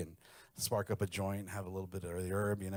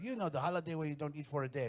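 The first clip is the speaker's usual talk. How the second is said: put-on Mexican mother-in-law accent; 6 semitones higher than usual. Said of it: "and she'd be like".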